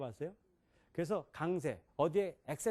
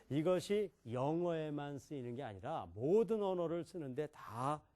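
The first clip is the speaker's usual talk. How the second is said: usual speaking voice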